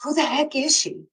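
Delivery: high, incredulous who the heck; mock baffled coach asking about the rookie; exasperated coach. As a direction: toss it up in disbelief. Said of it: "And then he goes".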